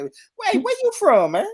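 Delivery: high, loud mock British asking; a Brit asking where you're from, overdone; British accent impression. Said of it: "Everywhere you go, say".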